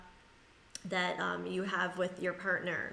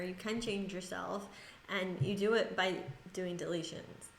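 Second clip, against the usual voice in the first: normal talking voice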